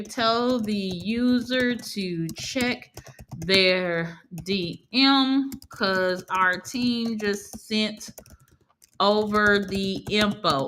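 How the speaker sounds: word by word, drawn out; slow dictation. Shown as a demonstration. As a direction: dictate slowly as if typing each word. Dictating a prompt while typing it.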